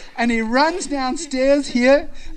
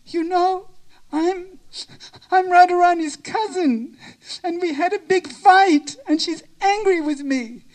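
high, halting acted distress; mock-tearful complaint in a high voice; tearful, hardly able to speak; 8 semitones higher than usual. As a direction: break it up as if choked by tears. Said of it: "she can hardly speak".

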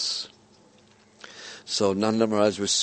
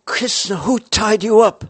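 much higher and louder, demanding to know; loud high question; 11 semitones higher, 9 dB louder than usual. Acted. Same question asked again, louder.

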